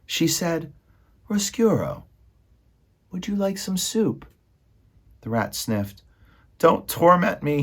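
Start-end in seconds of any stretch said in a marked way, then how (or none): 1.3-4.2 high, careful princess voice; kindly princess. A narrator's reading. Princess offers soup to her enemy. 6.6-7.6 tearful plea. A narrator's reading. Sniffling rat begs the princess.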